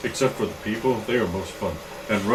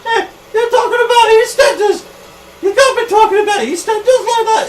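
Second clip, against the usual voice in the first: high, loud outburst; indignant local; 10 dB louder than usual. Acted. Local outraged at talk about EastEnders, high-pitched indignant yell. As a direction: butt in at the top of your pitch. Angry local butts in, acted.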